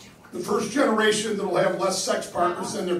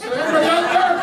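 walkie-talkie call; 6 semitones higher than usual. Pretend. Lonely single walkie-talkie call.

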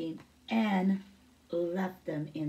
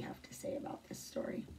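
soft unvoiced whisper aside; 13 dB quieter than usual. Real, not acted. Teacher whispering an aside to the class. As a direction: hush it like a secret aside.

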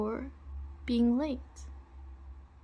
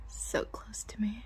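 whispering; 5 dB quieter than usual. Acted.

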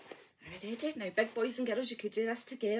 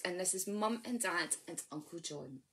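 knee rhyme; 4 semitones lower than usual. Acted. Leader reciting to babies on knees, hushed. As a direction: draw the little ones in.

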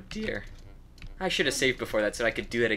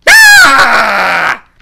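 huge high scream of defeat; death yell; 11 semitones higher, 21 dB louder than usual. Real, not acted. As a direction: let out a full death yell. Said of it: "that death yell".

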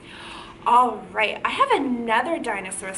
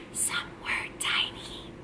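whisper; 11 dB quieter than usual. Shown as a demonstration. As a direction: whisper it as if tiny. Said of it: "We gotta whisper that part".

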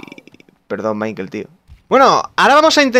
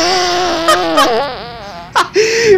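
risa aguda que estalla; ríete a carcajadas, agudo; 11 semitones higher than usual. Real, not acted. Risa al soltar el pedo. (carcajada aguda y descontrolada)

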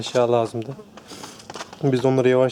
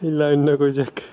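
usual speaking voice

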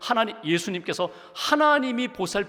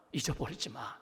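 잊어버리지 said much softer and lower, gently; soft reminder; 5 semitones lower, 8 dB quieter than usual. Acted. God reminding the weary believer, much softer. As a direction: murmur 잊어버리지 마 like a quiet promise.

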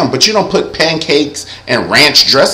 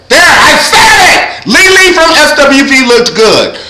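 laughing shout; 9 semitones higher, 3 dB louder than usual. Real, not acted. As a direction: shout it high and loud, laughing.

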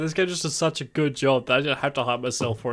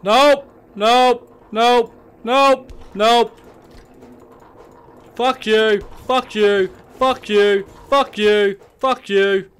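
high, loud repeated panicked shouts; panicked yelling; 8 semitones higher, 8 dB louder, much slower than usual. Real, not acted. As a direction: shout while running away. Fleeing the monster in panic.

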